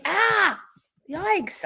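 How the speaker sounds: sudden high yelp; startled. Real, not acted. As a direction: jump in surprise. Startled by dog.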